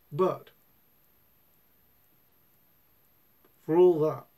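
speaking around pipe stem; pipe in mouth. Real, not acted. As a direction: teeth clenched on pipe. Seen 1: Pipe stem clenched in his teeth throughout, smoke rising.

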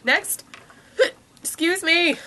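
hiccup breaking into the words. Real, not acted. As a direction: talk through a hiccup with a small laugh. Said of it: "I have the hiccups".

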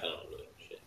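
soft, toneless throwaway; muttered aside. Real, not acted. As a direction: mumble it to yourself. Self-deprecating aside.

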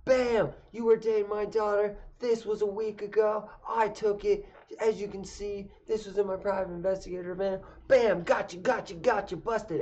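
high-pitched, giddy, hammed-up gloat; gloating rambling. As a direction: rattle it off like a smug detective. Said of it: "And he starts rambling".

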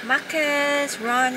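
loud call to run. Real, not acted. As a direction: shout it across. Calling to someone.

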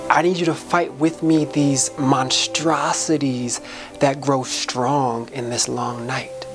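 ordinary talk, nothing marked

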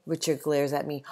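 plain reading voice

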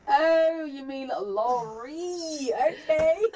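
mock dawning comprehension, stretched out with a laugh at the end; acted realisation. Re-enacting the realisation, drawn out.

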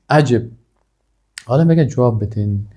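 ordinary delivery

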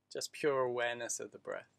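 calm, unhurried meditation voice; guided meditation. Acted. Teacher guiding listeners through breathing, meditation voice. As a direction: ease them into the breath.

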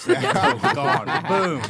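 high, loud, slow laughing repeat. Real, not acted. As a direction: crack up saying it. Repeats robot line laughing.

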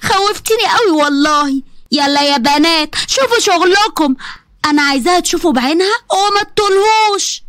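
sneer, then order the women; a touch of sarcastic cruelty; mocking mother-in-law.

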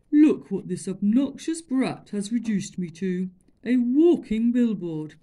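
loud, booming, slowing on billboard; indignant elephant. Acted. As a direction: complain with wounded pride. Elephant complains.

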